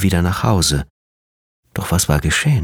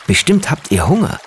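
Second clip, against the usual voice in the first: put-on child voice; 10 semitones higher than usual. A narrator's reading. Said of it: "meinte der Junge".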